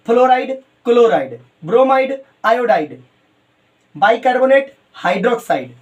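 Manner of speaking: each term alone, with a gap after it; unhurried dictation. Real, not acted. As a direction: dictate a word list at note-taking speed. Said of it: "धीरे से आराम करके note करो".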